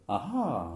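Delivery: understated, lengthened "ah ah"; earlier "ah ah". Shown as a demonstration. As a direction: demonstrate the old "ah ah", restrained and lingering. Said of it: "de tout à l'heure".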